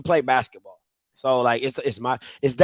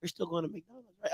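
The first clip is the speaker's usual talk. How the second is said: put-on very soft, small child asking; hushed imitation of a persistent kid; quiet little-boy question; son's voice; 2 semitones lower, 17 dB quieter than usual. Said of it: "My son's like".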